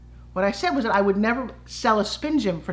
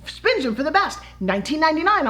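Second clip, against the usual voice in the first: teleprompter stumble; 7 semitones higher than usual. Retold or imitated. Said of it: "the teleprompter says".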